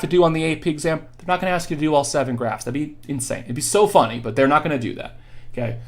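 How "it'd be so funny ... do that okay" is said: usual speaking voice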